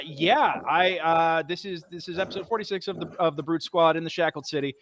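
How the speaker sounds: normal talking voice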